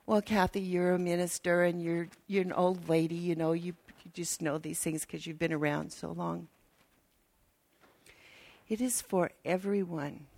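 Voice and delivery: ordinary delivery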